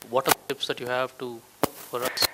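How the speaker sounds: ordinary talk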